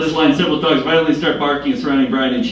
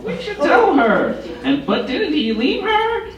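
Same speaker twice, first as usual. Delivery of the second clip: high, slow gossiping elder; gossipy old person voice; slower than usual. Retold or imitated. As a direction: quote the old folks in a high voice. Narrator voicing gossiping old people.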